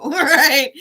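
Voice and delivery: big laugh, pitch way up; real burst of laughter; loud high laugh. Real, not acted. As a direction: laugh out loud, then ask. Laughs at own line.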